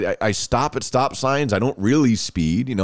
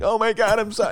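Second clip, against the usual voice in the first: high-pitched mock panic; retold freak-out; 10 semitones higher than usual. Teen caught by the cop who waited for him, mock panicked. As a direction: act out a panicked teen's high plea. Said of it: "and I'm freaking out".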